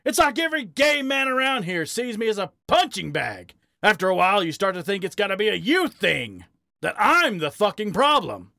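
high-pitched, strained frustration; agitated, wounded rant; hurt outburst. A narrator's reading. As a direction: vent it bitterly, pitch way up. Harry's hurt outburst continues.

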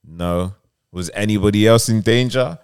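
usual speaking voice

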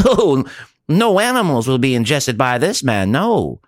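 high, pompous mock-proclamation; sneering parody of the vegan. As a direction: ham up a self-important vegan. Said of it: "makes a big deal about the fact that he's vegan".

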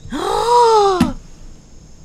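high delighted gasp; excited gasp. Real, not acted. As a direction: gasp in thrilled surprise. Joyful gasp at saying word.

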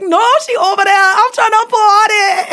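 put-on sing-song New Orleans party girl; New Orleans accent. Mimicking the girls from the New Orleans YouTube vlogs. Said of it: "I was able to mimic ... these girls being like".

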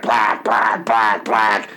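low-pitched cartoon squawking; mock Richard Nixon. Nixon butting in at the lunch table, mock impression. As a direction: blurt it repeatedly in a put-on low voice. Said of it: "Richard Nixon would be like".

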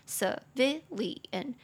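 civilian slowly, stress on VI; stress on second syllable. Shown as a demonstration. Teacher showing where civilian is stressed, slow with weight on VI. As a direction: separate the syllables of civilian, leaning on VI. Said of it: "中音节在第二".

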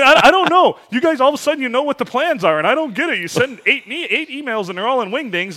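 high, loud whiny coworker impression, half laughing; high mock whine. Mocking the confused intern who can't read wingdings, high whiny voice.

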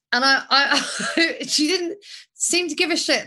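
laughing through the words. Real, not acted. Laughing while recalling friend.

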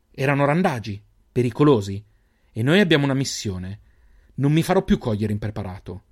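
ordinary delivery, nothing marked

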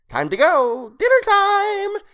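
very high, loud, drawn-out singsong cry; gleeful creature call. A narrator's reading. Creature off to eat the body, gleeful shriek. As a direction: crow it like a delighted ghoul.

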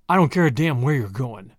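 low, surly growl. A narrator's reading. Tommy dismisses the departing Julius, low growl. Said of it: "growled Tommy".

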